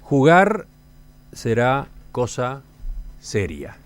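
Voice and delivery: scripted presenter voice with emphatic key words; radio intro read. Acted. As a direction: announce the topic with deliberate pauses and lively pitch swings. (locución de presentación radiofónica)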